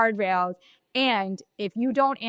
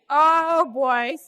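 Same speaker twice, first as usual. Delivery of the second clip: a burst of caught-out surprise, higher and louder; playful oh-no surprise; mock dismayed oh boy; 7 semitones higher, 6 dB louder than usual. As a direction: retell the gulp of being found out. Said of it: "And I was like".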